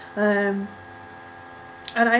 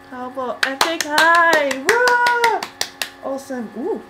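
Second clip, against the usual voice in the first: excited cheer; 6 semitones higher, 5 dB louder, slower than usual. Real, not acted. Cheering for the band.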